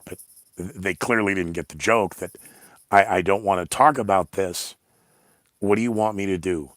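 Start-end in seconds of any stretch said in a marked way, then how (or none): none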